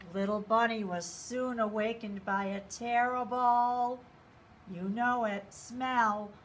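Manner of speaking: ordinary delivery, nothing marked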